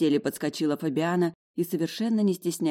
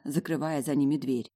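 ordinary delivery

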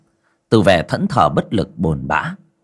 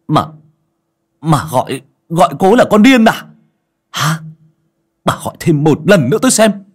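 rising to a loud, higher, fast roar; furious threat; 8 semitones higher, 6 dB louder than usual. A narrator's reading. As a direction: build from a grumble to shouting her down. Furious son threatens his mother.

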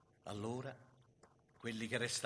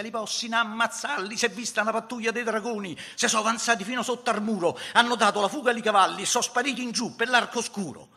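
high, loud rush of news; urgent alarm report; 10 semitones higher, 11 dB louder than usual. Acted. Sentry reporting the patrol to his comrades, urgent and loud. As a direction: raise the alarm like a winded lookout bringing news.

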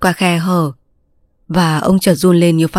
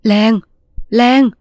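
raised, urgent call into the night; an old man calling his tiger louder into the dark; 5 semitones higher than usual. A narrator's reading. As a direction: call the name out high and loud. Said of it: "Lần này ông gọi to hơn".

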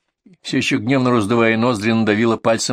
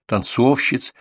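plain reading voice

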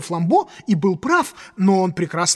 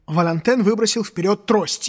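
ordinary delivery

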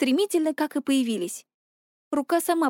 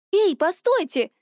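higher-pitched, drawn-out call to stop; slow, urgent objection, voice raised in pitch; protesting; 6 semitones higher, 3 dB louder, slower than usual. A narrator's reading. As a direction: call after them in protest. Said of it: "запротестовала Вета".

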